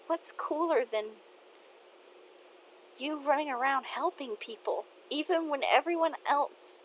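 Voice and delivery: put-on young daughter telling dad he's her hero; daughter's voice.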